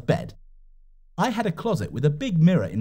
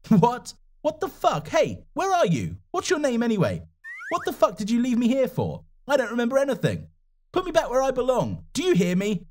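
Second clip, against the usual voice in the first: panicked yelling; 10 semitones higher than usual. Acted. Abandoned alone in mountains.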